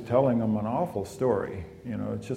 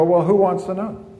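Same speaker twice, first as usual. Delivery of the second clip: higher, louder playful comeback; teacher's retort; 7 semitones higher, 7 dB louder than usual. Retold or imitated. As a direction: toss the question right back at them. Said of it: "he'd go".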